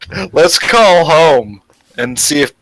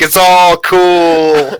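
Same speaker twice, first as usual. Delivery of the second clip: put-on Russian accent; 8 semitones higher, 4 dB louder, much slower than usual. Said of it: "Just be like".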